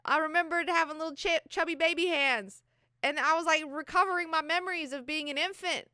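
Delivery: high, loud, overjoyed bragging; excited yelling. Retold or imitated. Young man crowing to Jessica about his success, high and loud. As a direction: shout it like you just pulled off a miracle.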